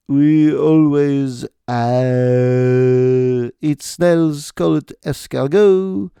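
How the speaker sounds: lazy exaggerated French accent. Mocking how the French talk, mock French accent. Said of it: "Or as the French say".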